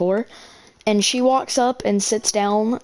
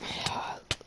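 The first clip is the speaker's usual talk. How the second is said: weary sigh; 15 dB quieter than usual. Real, not acted. Exasperated sigh before retelling.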